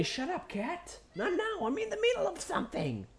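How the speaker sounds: high, soft hiss at the cat; hushed scolding. Real, not acted. Host hushes his cat mid-recording, high and soft. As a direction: shoo the cat quietly.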